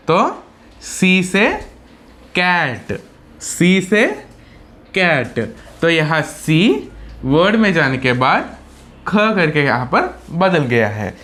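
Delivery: normal talking voice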